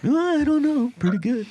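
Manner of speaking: high far cartoon voice; cartoon impression. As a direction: squeak it like a cartoon. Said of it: "it's an impression".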